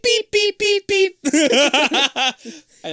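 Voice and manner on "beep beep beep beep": squeaky high beep beep; high horn beeps. Pretend. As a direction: chirp it like a cartoon horn. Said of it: "it goes beep".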